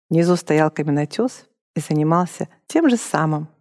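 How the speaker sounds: normal talking voice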